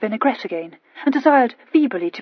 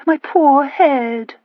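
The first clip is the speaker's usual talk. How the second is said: slow, high, loud moan; mother moaning about the draught, slowly; 5 semitones higher, 6 dB louder, much slower than usual. A narrator's reading. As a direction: drag the words out like a swooning lady. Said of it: "she moaned".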